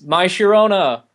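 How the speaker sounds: lightly yelled song request; imitated heckler yell. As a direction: call it out like a heckler, half-volume. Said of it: "Guy in between song yells".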